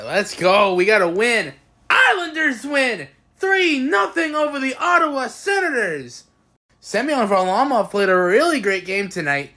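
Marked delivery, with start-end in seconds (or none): 1.9-6.2 high, drawn-out arena announcer call; victory announcement. Real, not acted. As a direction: proclaim the score triumphantly.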